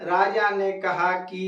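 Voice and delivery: राजा ने कहा कि, slow, holding कि long; drawn-out "कि". Shown as a demonstration. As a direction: linger on कि to make it long. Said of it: "देर करके बोला जाए".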